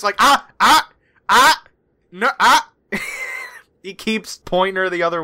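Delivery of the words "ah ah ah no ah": short, loud, high warning noises; scolding "ah-ah". Acted. Character keeps turning her aim away, sharp scolding "ah-ah". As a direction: cut them off sharply like correcting a pet.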